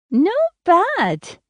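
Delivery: high nasal verdict; grating, nasal. A narrator's reading. Seatmate judging the American's first attempt, grating and nasal. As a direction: pitch it up, nasal and approving. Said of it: "She has a voice that grates, almost nasal".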